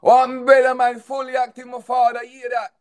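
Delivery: high, animated Jamaican patois burst. Acted. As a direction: burst into loud, high-pitched Jamaican banter. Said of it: "A Jamaican".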